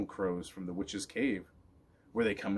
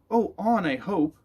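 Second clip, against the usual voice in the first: hopeful dog; 8 semitones higher, 6 dB louder, slower than usual. A narrator's reading. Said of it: "dog hoping".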